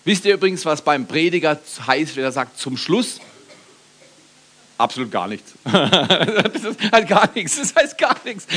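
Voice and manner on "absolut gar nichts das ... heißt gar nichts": laughing punchline. Real, not acted. Joke about preachers.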